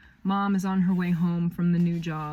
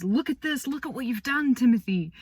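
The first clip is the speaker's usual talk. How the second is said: higher, beaming with pride at her son; proud mom; 7 semitones higher, 4 dB louder than usual. Retold or imitated. Mom praising Timothy for the money he raised, proudly. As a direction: praise him like a proud mother. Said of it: "Timothy's mom says".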